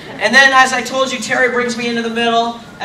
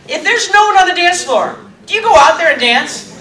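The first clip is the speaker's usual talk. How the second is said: pitched-up, louder rhetorical question; incredulous; 8 semitones higher, 5 dB louder than usual. Real, not acted. Actor asks the audience who dances alone, incredulous. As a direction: put it to the crowd, high and emphatic.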